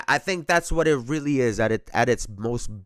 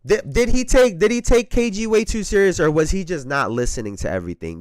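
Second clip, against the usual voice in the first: ordinary talk, nothing marked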